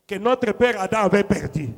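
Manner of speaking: usual speaking voice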